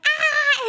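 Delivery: high, loud imitated scream; comic full-voice yell; Mark's mock scream. Recapper imitating Mark screaming at the nurse, loud mock scream. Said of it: "screaming back at each other".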